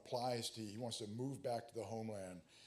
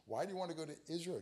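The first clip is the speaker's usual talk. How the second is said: higher, fast mock interrogator: "Why do you want to go to Israel?"; KGB agent voice; 7 semitones higher, much faster than usual. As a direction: fire the question up high and quick like a suspicious agent. Retakes KGB line in character.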